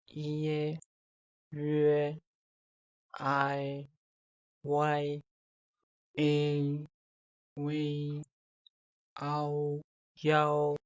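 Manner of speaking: each Chinese final said clearly and slowly for learners; Chinese finals modelled. Shown as a demonstration. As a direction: sound out each final like a teacher. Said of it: "Now I'm going to repeat them".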